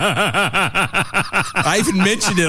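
slow words pushed through laughter; laughing. Real, not acted. Telling a friend the prank, laughing slowly. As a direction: laugh while you get it out.

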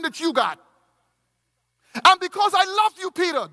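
high and loud, pressing on; rising fervour, pushing each line harder; impassioned preaching. Acted. Preacher voicing Christ, building.

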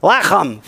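Lechem with a harsh throat-clearing ch; guttural ch. Shown as a demonstration. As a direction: rasp the ch in Lechem. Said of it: "Like you're hawking up something".

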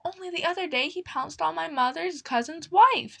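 high, trembling squeak of fear; fearful plump mouse, voice quivering; quivering, frightened. Acted. Plump mouse frets to the others, high and quivering. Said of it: "quivering like jelly".

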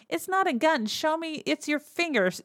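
high-pitched mocking retort. Terrence Mann to Ray about his fake gun, mocking. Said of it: "kind of mocking him".

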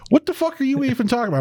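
high-pitched incredulous question; mock exasperated disbelief; baffled. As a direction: imitate a bewildered partner, pitch way up. Retold partner baffled by sudden rant.

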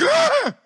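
sudden high, loud shriek; hammed-up cry of terror; loud startled scream. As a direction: scream as if seeing a ghost. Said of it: "she cried out with a loud voice".

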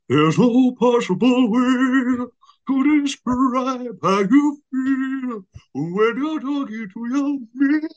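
Tom Wilkinson impression. Wilkinson as the wolf, slow high mock opera. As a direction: stretch it out high and grandly. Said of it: "Tom Wilkinson".

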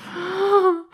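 sharp gasp of pain. Real, not acted. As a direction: suck in air through the hurt. Just hit ankle on desk.

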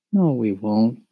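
usual speaking voice

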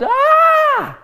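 a sudden high cry of shock; retold shout. Healed woman on the phone, sudden high cry. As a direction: cry out high as feeling returns. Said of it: "I heard the woman shout".

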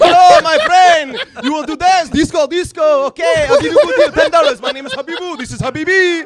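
very loud, high mock Middle Eastern accent; boisterous hustler with a Middle Eastern accent. Comic imitating a guy selling and dancing, Middle Eastern accent. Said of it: "Middle Eastern guys".

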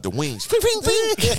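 high sung pings for the wings; sung wing sound. Retold or imitated. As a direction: chime the wings opening in a high voice. Gargoyle wings sound effects.

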